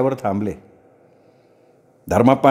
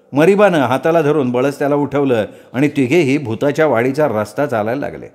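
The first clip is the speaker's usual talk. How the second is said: ordinary delivery, nothing marked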